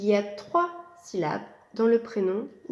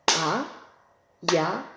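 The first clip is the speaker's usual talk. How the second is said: A-ya-na in three separate beats; syllables tapped out; 3 semitones lower than usual. Shown as a demonstration. Teacher to pupils, scanning a three-beat name. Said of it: "Trois syllabes".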